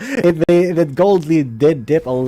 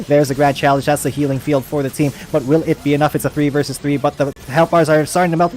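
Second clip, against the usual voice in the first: usual speaking voice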